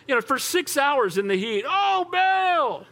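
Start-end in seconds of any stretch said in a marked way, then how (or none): 1.7-2.9 very high, stretched-out call; hammed-up wail to a silent god; crying out. Prophets six hours in the heat, high long cry. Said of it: "they're just crying out to Baal".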